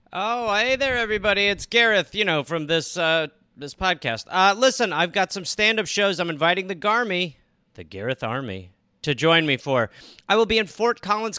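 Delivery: lightly voiced promo read; tour ad read. Inviting the Gareth Army to his shows, promo read. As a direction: pitch the stand-up dates to fans.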